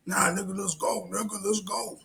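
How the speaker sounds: high-pitched mock taunt; mock challenger. Challenger calling the worker out to fight, taunting.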